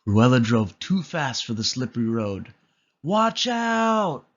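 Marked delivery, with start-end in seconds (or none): 3.0-4.3 high, loud panicked warning; crying out. A narrator's reading. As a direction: yell before the crash. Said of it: "cried Horace".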